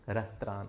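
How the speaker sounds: रेस्तरां as "res-trah", nasal end; American pronunciation. Shown as a demonstration. Teacher showing students the American रेस्तरां. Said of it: "इधर इसको बोलते हैं रेस्तरां".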